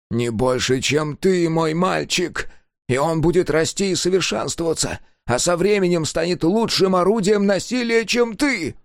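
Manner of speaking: high, gleeful fanatic's voice; manic engineer. A narrator's reading. Engineer grinning manically at Sega, high and gleeful. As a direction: preach the new species with mad delight.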